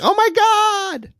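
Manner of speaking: high, drawn-out mock shriek of oh my God; mock scream. Country of people screaming oh my God, high hammed-up shriek. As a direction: shriek it like a giddy American teen. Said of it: "people screaming".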